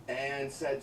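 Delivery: ordinary talk, nothing marked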